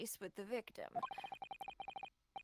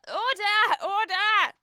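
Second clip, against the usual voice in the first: high, loud gavel-banging shout; shouting order; 11 semitones higher, 17 dB louder, much slower than usual. Acted. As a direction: bellow it at the court. Judge calling for order.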